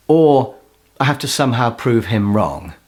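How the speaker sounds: ordinary delivery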